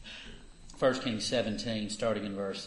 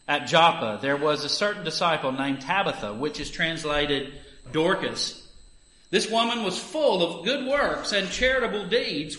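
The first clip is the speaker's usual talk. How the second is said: raised, earnest Bible reading voice; scripture reading; 4 semitones higher, 8 dB louder than usual. Acted. Reader giving the morning lesson to the congregation, earnest scripture reading. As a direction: read the passage out like from the pulpit. Reads Acts nine aloud.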